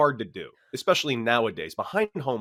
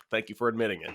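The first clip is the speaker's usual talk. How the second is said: calm retold reply; 5 semitones lower, 7 dB quieter, faster than usual. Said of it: "immediately calmed down".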